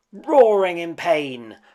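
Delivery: usual speaking voice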